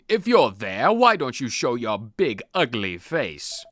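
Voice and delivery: bold shouted taunts; loud taunting. Acted. Schoolboy calls out the ghost, loud taunting. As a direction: yell at the ghost to show itself. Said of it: "normally process very loud screams, shouts".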